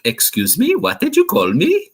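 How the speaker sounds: rising sharply into a high offended squeak on the question; imitating an angry near-Swedish accent, voice jumping high; mock angry Swedish-ish accent. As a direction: play the offended wrestler in a near-Swedish lilt. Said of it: "that's what he sounds".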